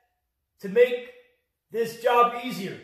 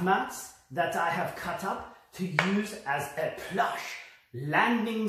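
suave French designer, softer voice; put-on French accent; 4 semitones lower, 6 dB quieter than usual. French designer showing viewers his materials in a soft, flirty French accent. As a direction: show off your wares with a hammed-up French accent.

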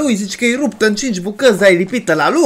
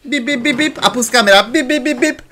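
camera beeps; 6 semitones higher than usual. Retold or imitated. Camera placement beeps imitated.

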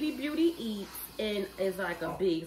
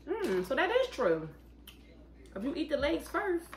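small bite in mouth; picking crab and eating; chewing while talking. Real, not acted. Seen 2: Picks crab meat, brings small bite to mouth ~2.1s, then chews.

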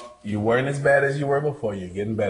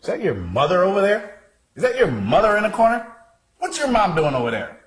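higher and louder, as if startled by something in the corner; fake alarm; 8 semitones higher, 3 dB louder than usual. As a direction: point out a sudden shock to make him look. Coach feigns alarm to distract.

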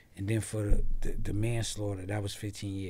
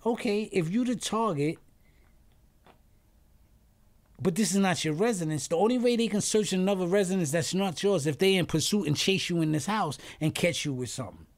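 lawyer; 6 semitones higher, 4 dB louder than usual. Retold or imitated. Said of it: "So my lawyer's saying".

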